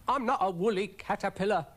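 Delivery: high, offended little snail; affronted cartoon creature, high-pitched; indignant denial. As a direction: protest the mistake in a high voice. Brian indignantly corrects her.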